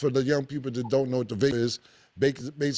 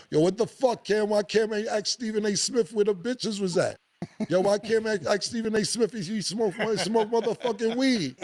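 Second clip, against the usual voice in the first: imitating barbershop critics; 7 semitones higher than usual. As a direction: play the annoyed barber customer heckling Cam. Barbershop guys mad at show.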